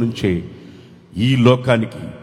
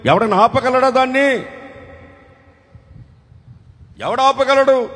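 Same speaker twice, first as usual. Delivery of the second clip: rhetorical cry; 10 semitones higher than usual. Real, not acted. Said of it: "preacher exclaims rhetorical question".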